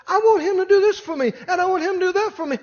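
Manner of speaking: high imitated lady's voice; complaining wife. Voicing the lady insisting.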